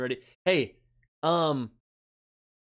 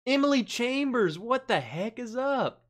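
higher, lively hello; excited greeting; 8 semitones higher than usual. Real, not acted. Host greets viewer Emily in chat, excited hello. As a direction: hail her warmly across the room.

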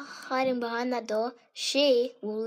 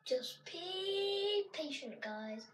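slow, soft, raised whisper; hushed; 4 semitones higher, 13 dB quieter, much slower than usual. Real, not acted. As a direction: keep it quiet while hiding. Hiding behind door, hushed.

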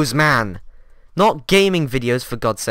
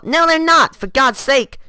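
much higher, louder outraged protest; indignant yell; 8 semitones higher, 5 dB louder than usual. Acted. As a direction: snap back in outrage, loud and high. Defending his own videos.

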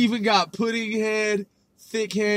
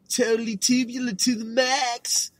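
put-on Valley Girl; 4 semitones higher than usual. Quoting eighties slang.

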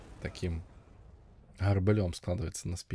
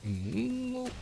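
surprised. Real, not acted. Tense moment in fight.

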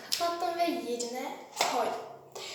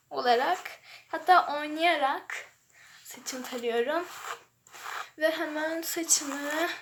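ordinary talk

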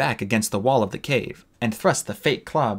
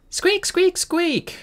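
much higher shouted squeaking; mock squeaks; much slower than usual. Clay playing a scavenger, squeaking much higher in mock. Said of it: "he shouted".

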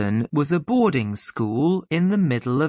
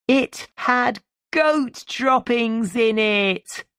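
high, slow, drawn-out reveal; high child's voice savouring the secret; gleeful little sister; 10 semitones higher than usual. A narrator's reading. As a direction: announce it like a delighted child, pitched way up.

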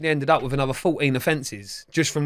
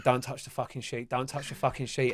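very soft, lowered voice, urgent thought; hushed inner plea; 4 semitones lower, 11 dB quieter than usual. Retold or imitated. Fugitive willing the man off the tarpaulin, hushed. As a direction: plead silently from a hiding place. Silent plea while hiding.

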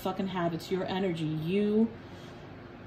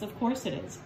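"Of course it is" said low and softer, flat and knowing; low, quieter, resigned "Of course it is"; low dry take; 5 dB quieter than usual. Shown as a demonstration. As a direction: drop "Of course it is" into a deadpan mutter.

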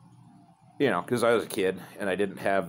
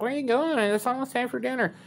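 much higher-pitched imitation of mom; voiced mom. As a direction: imitate mom calling out in a high voice. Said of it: "my mom's like".

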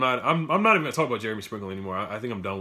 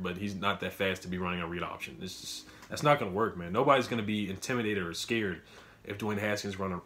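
ordinary talk

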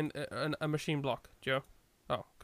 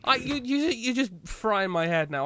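exasperated; 7 semitones higher, 6 dB louder than usual. Real, not acted. Exasperated outburst at partner.

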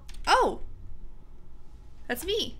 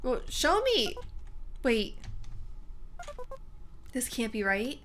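normal talking voice

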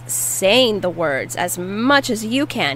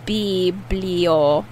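syllable by syllable. Shown as a demonstration. Teacher breaks word into syllables.

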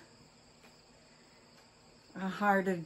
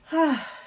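long sigh that falls away; 7 semitones higher, 5 dB louder than usual. Real, not acted. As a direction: let out a big heavy sigh. Big sigh before opening mail.

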